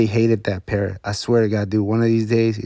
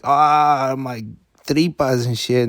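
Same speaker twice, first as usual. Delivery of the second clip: pained groan; 3 semitones higher, slower than usual. Retold or imitated. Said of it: "And he's like".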